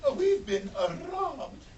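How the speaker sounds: high, tearful, faltering voice; distressed wife. Acted. Missus Smith dismayed at the robbery, high and tearful. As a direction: stammer it in dismay. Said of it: "Missus Smith said".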